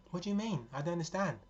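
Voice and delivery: high, bewildered protest; startled daughter questioning; confused. Retold or imitated. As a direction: plead not understanding. Confused reply to shop woman.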